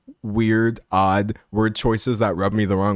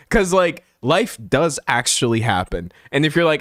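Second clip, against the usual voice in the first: ordinary talk, nothing marked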